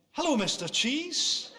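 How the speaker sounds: put-on high, slow singsong for a toddler; kid mode. Dad greeting supermarket cheese, imitated talking-to-kids voice. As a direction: greet it like a children's presenter. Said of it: "I was in that mode".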